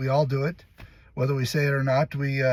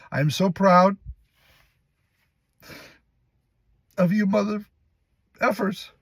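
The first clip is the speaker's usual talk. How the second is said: high, halting, fighting back emotion; choked up; 8 semitones higher, 3 dB louder than usual. Acted. Boss telling staff he's proud of them, choked up. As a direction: break off mid-line, overcome with pride. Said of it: "he gets choked up".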